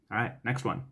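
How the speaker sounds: ordinary talk, nothing marked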